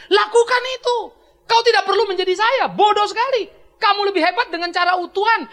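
high, loud fiery preaching. Acted. Pastor rebukes the congregation in full fiery preaching. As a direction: hammer the congregation with conviction.

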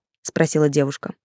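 ordinary delivery

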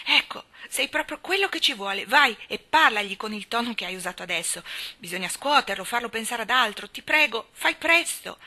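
urgent pleading nurse. Acted. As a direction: push her to go now. Nurse urges Mary to hurry.

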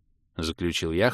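ordinary delivery

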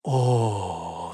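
long, low, soft sigh of pleasure. A narrator's reading. Enchantress in Holger's arms, sighing. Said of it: "вздохнула она".

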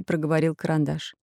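usual speaking voice

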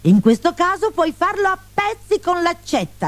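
voce alta e tesa da figlia esasperata; sfida accorata. A narrator's reading. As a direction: supplica acuta e insistente. Colombina sfida il padre. (sfida accorata, voce acuta)

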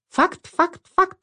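very high squeaking chorus; squeaky tiny voices. Acted. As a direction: squeak like little creatures. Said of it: "запищали голоса". (тоненький писк хором)

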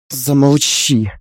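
slow, heavy command to stop; slow hush. A narrator's reading. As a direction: drag it out, shutting her up. Mother-in-law cuts her off.

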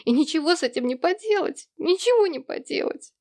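high, wailing lament; bawling. A narrator's reading. Daughter bewailing her looks to her father, bawling. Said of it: "Ревя как телок".

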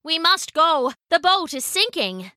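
urgent pirate woman; urgent warning. A narrator's reading. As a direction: hurry him out with alarm. Hana warns boy of sinking boat.

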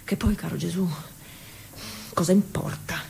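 breathy whispered question to herself. Acted. Talking to Jesus under her breath, in a whisper. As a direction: let the question slip out as a whisper.

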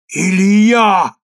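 loud, high drawn-out shout; crowd's cry. A narrator's reading. Crowd calls on Elijah, loud cry. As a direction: cry the name to heaven. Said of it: "разом закричали все евреи".

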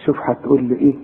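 ordinary talk

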